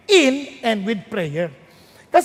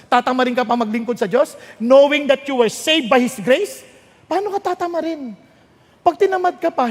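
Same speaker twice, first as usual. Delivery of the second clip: ordinary delivery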